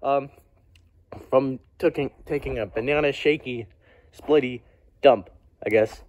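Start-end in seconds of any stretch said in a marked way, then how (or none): none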